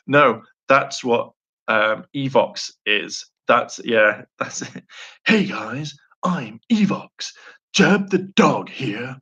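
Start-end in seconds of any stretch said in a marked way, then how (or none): 5.2-9.2 slow, sing-song cartoon dog voice; Jeb the Dog. Dog announcing he is Evox, slow put-on cartoon voice. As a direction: stretch the words in a playful character greeting.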